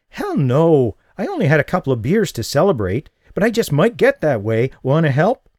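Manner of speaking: high and loud, playful; tipsy winner teasing him, high and loud; tipsy excitement. Acted. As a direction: brush it off with a giddy laugh in the voice. Tipsy woman laughing off question.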